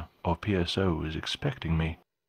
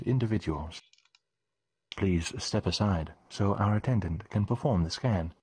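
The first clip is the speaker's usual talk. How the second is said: lightly voiced formal guard; courteous checkpoint official; polite guard voice.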